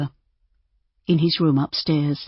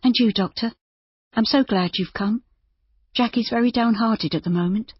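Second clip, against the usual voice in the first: put-on young woman voice; 6 semitones higher than usual. Narrator voices Judy.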